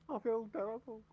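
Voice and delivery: overplayed guilt; mock guilty dog owner; mock apology. Plays the fighter apologising.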